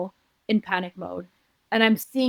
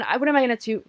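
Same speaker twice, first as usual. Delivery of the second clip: ordinary delivery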